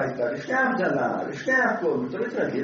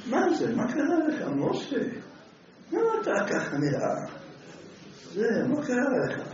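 shocked rabbi; 7 semitones higher than usual. Acted. Rabbi shocked at Moshe's face.

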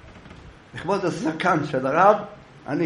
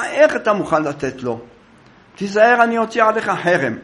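stern rebuke; 8 semitones higher, 6 dB louder than usual. Acted. Rabbi rebuking the rich thief before the court, loud and stern. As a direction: thunder at the thief with righteous anger.

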